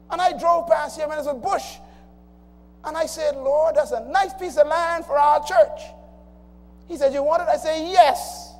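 high, loud preaching, building to shouts; full-force preaching. Acted. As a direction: drive the sermon home to the congregation.

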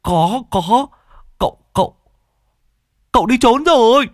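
high, strained call for the master; voiced henchman calling out. A narrator's reading. Servant calling his master, high and strained. Lâm Sẹo calling out to Cậu.

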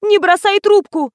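high, loud begging; panicked, pitched right up; desperate plea. A narrator's reading. Begs lover not to hang up.

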